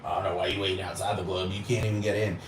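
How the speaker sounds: low, fast, muttered mock quote; mocking the haters. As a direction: toss off the haters' line quietly and quickly. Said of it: "Yes, I'm happy that I can't get in".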